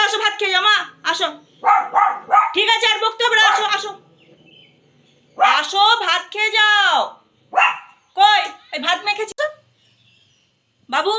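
loud, high call across the house; loud high-pitched calling to the pet to come eat. Real, not acted.

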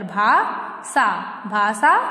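"भाषा" broken slowly as "bhaa... saa"; wrong pronunciation. Shown as a demonstration. Teacher showing the incorrect word, mispronounced. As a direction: use plain s in "भाषा". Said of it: "अशुद्ध शब्द है".

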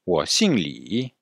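wo xing Li said at half speed; slow model phrase. Shown as a demonstration. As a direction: spell out wo xing Li slowly for students. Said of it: "Répétez encore une fois". (wo xing Li, très lentement)

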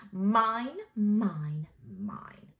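loud possessive mine, fading to a whisper; greedy kid claiming every lollipop; greedy child. Acted. Greedy girl clutching lollipops.

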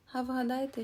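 usual speaking voice

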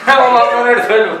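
loud. Real, not acted. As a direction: shout it in disbelief. Repeat louder, exasperated.

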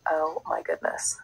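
slow breathy whisper of awe; awed whisper. Real, not acted. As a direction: whisper it in wonder. Amazed at the result.

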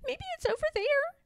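very high, quick tiny bird; unsure baby robin. A narrator's reading. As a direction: chirp a quick guess.

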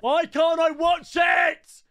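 high, loud, drawn-out wail of fury; outraged rant. Real, not acted. Gamer raging at a rigged penalty, outraged shout. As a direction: yell it in high-pitched exasperation.

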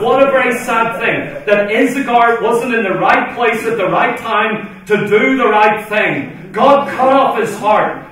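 loud, high revival preaching; full-force preaching. Real, not acted. Preacher urging the saints of God, loud full-force preaching.

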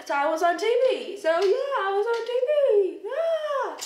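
high, loud gleeful cheer; giddy, rising excitement; excited celebration. Real, not acted. Celebrating having been on TV.

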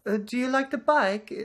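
badly faked French accent, high-pitched. Shopkeeper checking on the rider of the display bike, French accent. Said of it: "how to do a French accent".

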